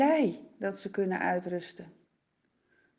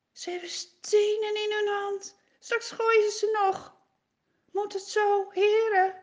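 frightened Moses; 10 semitones higher than usual. Acted.

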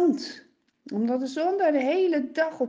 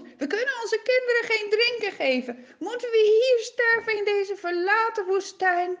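higher, louder rising anger; angrier; 10 semitones higher, 5 dB louder than usual. Acted. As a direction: build the fury further. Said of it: "steeds kwader, steeds bozer".

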